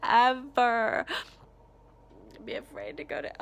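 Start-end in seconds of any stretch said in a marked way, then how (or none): 0.0-1.1 ever burst out much louder; emphatic loud ever. Real, not acted. Vlogger begging that no child fear school, loud outburst. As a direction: shout the last ever as a plea.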